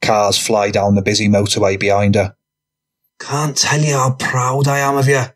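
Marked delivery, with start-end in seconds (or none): 3.2-5.2 higher-pitched lad praising a companion; Connor. Acted. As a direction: voice a character offering praise. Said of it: "Connor says".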